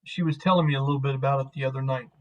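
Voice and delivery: ordinary talk, nothing marked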